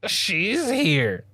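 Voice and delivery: high, drawn-out fan awe; geeking out. Retold or imitated. Fan seeing Lena arrive at last, geeking out. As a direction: pitch it up high like a thrilled fan. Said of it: "you are geeking right now".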